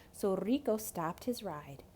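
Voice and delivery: usual speaking voice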